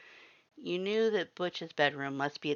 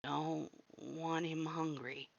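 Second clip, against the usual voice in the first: growled; 8 dB quieter, slower than usual. A narrator's reading. Axe growls to his plate, slow and soft. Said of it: "Axe growled to his plate".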